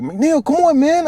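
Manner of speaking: a squeaky friend urging him on; high mock buddy voice. Retelling a platoon buddy urging him on, high mock voice.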